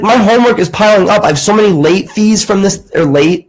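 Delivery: high, fast, rising frustration; exasperated pleading. Retold or imitated. Student to teacher about piling late assignments, high exasperated rush. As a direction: rattle it off like you're drowning in work.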